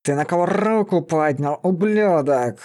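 angry beast-man; menacing growl. A narrator's reading. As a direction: growl the challenge with rolled r. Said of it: "раздался грозный рык".

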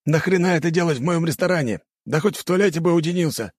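indignant yelling. A narrator's reading. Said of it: "закричал здоровяк".

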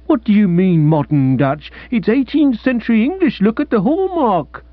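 high, pained protest; an expert wounded to the core, voice climbing; high indignant old dealer; agonized dealer. Acted. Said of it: "the agony of spirit was obviously intense".

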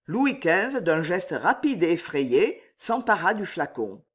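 ordinary delivery

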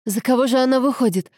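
mildly urgent demand for an answer; urgent interruption. A narrator's reading. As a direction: cut in, needing to know. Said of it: "перебила Анжела".

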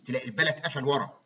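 usual speaking voice

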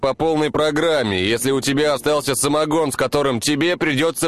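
high teasing man's call; joking call. Acted. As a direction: tease him as you approach. Mikhail calls jokingly to Danilych.

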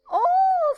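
very high, loud squeal; piercing shriek of hilarity; shrieking cry. A narrator's reading. Miss Kennedy in fits at the mimicry, shrieking. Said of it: "strillando, Miss Kennedy gridò".

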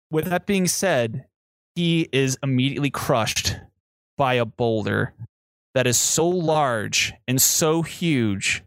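ordinary talk

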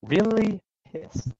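high, slow, drawn-out quoting of "really pissed"; mock quote. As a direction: hold it up as an absurd phrase.

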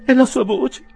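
high, loud falsetto gasp of recognition; hysterical exclamation. A narrator's reading. Spinster aunt hears Tupman speak, hysterical exclamation. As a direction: cry out in overwrought amazement. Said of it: "esclamò la zia Zittella".

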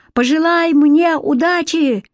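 high, drawn-out call. A narrator's reading. Conner calls to Alex before jumping, high call. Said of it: "крикнул Коннор".

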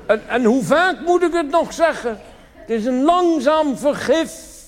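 high, loud, slow shrill nag; nagging wife. Acted. Fed-up wife warns her husband about drink, high nagging. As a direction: screech the warning like a fed-up wife.

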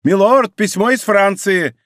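high, loud call; shouting. A narrator's reading. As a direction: shout the news out urgently. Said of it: "крича".

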